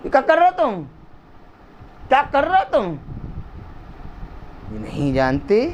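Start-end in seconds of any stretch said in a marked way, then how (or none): 0.0-2.9 very high, indignant demand; outraged rebuke. Acted. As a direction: challenge them in high-pitched outrage. Acted outraged rebuke of idol makers.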